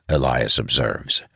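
plain reading voice

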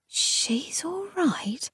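slow, breathy disbelieving whisper; incredulous whisper. Acted. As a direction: whisper it back in disbelief, slowly. Gideon echoes in disbelief.

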